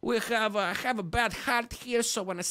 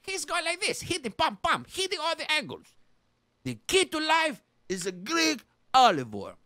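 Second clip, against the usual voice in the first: usual speaking voice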